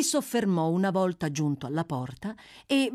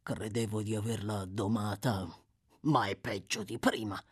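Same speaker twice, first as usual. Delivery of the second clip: low grumble, a little softer; Sykes grumbling; 7 semitones lower, 4 dB quieter than usual. A narrator's reading. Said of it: "borbottò Sykes".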